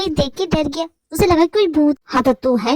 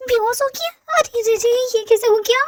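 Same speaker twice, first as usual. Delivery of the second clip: ordinary talk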